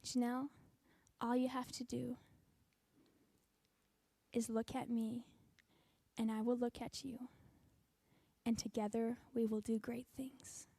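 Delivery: soft, mostly whispered; gentle whisper of Jesus speaking to her heart; quiet inner voice. Retold or imitated. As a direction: hush it like a voice heard in the spirit. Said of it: "in the quiet of my spirit".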